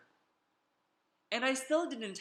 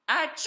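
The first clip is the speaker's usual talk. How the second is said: loud theatrical sneeze; hammed-up storybook sneeze; big sneeze; 9 semitones higher, 8 dB louder than usual. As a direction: let rip a huge fake achoo. Wolf acts out his sneeze.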